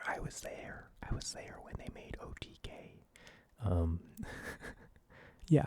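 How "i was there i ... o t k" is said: hushed conspiratorial whisper; whispered aside. Pretend. Vlogger whispering a secret boast to the camera. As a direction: breathe it like a secret. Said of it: "whispered aside repeating line".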